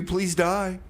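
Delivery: normal talking voice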